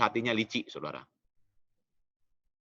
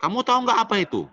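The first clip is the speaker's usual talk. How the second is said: firm demand; 9 semitones higher, 4 dB louder, faster than usual. Retold or imitated. Father questioning son firmly.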